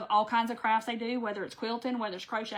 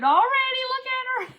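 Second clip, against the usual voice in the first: delighted gush; 13 semitones higher, 7 dB louder than usual. Real, not acted. Crafter showing viewers her finished piece, delighted high squeal. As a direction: pitch jumps way up as she gushes over the craft.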